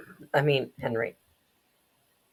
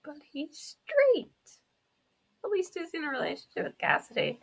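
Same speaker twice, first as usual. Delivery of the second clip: high, softer comic voice; squeaky child; 13 semitones higher, 4 dB quieter than usual. Pretend. Player objecting for Fredbear about the kiss, squeaky mock protest.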